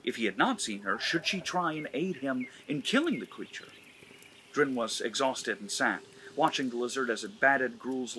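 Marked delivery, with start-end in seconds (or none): none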